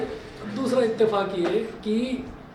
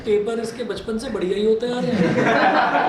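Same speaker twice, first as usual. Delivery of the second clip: louder mock sarcasm, half laughing; father needling his son in front of the teacher, louder and sarcastic; father's sarcastic jab; 3 semitones higher, 6 dB louder than usual. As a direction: fire it off as a sly dig. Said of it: "और तेज कान".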